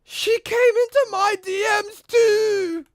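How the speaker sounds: hammed-up surprised friend; mock shocked friend. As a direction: play the stunned friend, drawn out. Friend's shocked reply, retold.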